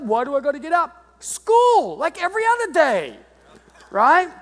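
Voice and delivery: put-on high, whining teen voice; whiny boy. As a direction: whine like a sulky boy. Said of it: "boy whining at being woken".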